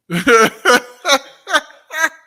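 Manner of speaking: a loud burst of laughter, high. Real, not acted. Laughing at the claim before the study group, loud.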